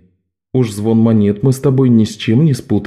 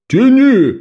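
sharp high yell, much louder; 12 semitones higher, 4 dB louder than usual. Acted. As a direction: bark the order across the water. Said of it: "проорал".

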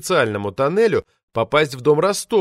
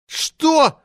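much higher, louder indignant yelp; outraged yelp; 5 dB louder than usual. A narrator's reading. Short administrator flares up at the insult with an indignant yelp. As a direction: shoot up in outrage. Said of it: "взвился наш Цербер".

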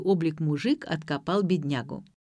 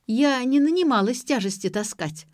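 higher-pitched sulky refusal; put-upon teenage protest; grumpy; 6 semitones higher, 4 dB louder than usual. A narrator's reading. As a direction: grouse at being given a chore. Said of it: "буркнула та".

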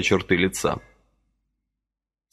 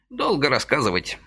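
put-on dismissive old man; 6 semitones higher than usual. A narrator's reading. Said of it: "отмахнулся старичок".

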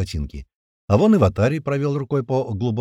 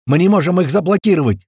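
high, loud alarmed cry; shouting; 8 semitones higher, 7 dB louder than usual. A narrator's reading. Second technician reporting failure to block gates, shouting. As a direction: shout that all is failing. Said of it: "закричал другой технарь".

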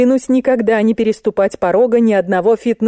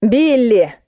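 higher, drawn-out correcting call; emphatic correction; 5 semitones higher than usual. A narrator's reading. Narrator corrects Musenka's mishearing, emphatic. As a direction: call the name to correct her.

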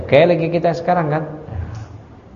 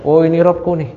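mild mocking; 4 semitones higher, 3 dB louder than usual. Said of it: "seakan-akan lagi ngeledek".

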